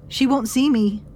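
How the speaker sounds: high loud cry of pain; woman in labor screams, high and loud; anguished scream. A narrator's reading. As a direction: cry out that the midwife won't see her. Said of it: "the woman screamed".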